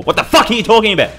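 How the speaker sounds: high, loud, incredulous rant; exasperated outburst. Real, not acted. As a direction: blurt it out in outraged disbelief. Outburst at online opinions.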